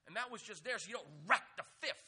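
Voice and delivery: aggressive. Real, not acted. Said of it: "that was said very aggressively".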